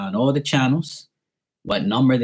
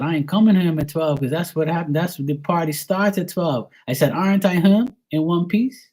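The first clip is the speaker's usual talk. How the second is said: higher, heated talk-back; heated argument; 7 semitones higher than usual. Retold or imitated. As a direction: argue back hard at dad.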